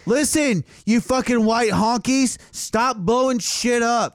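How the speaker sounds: mock scolding. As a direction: scold them like a fed-up parent.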